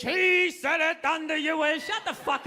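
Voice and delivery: slow, pompous mock sermon tone; mock old English preacher. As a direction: intone it like a robed preacher. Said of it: "speaking in old English".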